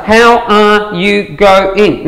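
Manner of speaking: very slow, word by word; drawn-out full syllables. Shown as a demonstration. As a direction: spell out HOW ARE YOU GO-ING syllable by syllable. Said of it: "What else?".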